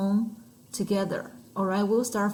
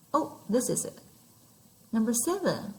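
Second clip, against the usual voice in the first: usual speaking voice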